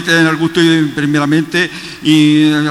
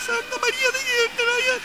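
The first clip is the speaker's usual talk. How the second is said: very high and much softer echo; faint echo; 8 dB quieter than usual. Shown as a demonstration. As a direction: answer faintly like a distant echo. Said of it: "El eco de una montaña".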